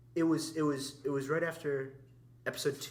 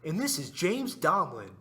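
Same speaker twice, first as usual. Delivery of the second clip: slow, higher-pitched self-introduction imitation; mocking impression of a friend; James Domlin; 4 semitones higher, 5 dB louder, slower than usual. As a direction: draw out the introduction in a caricature voice. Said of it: "And you talk like this".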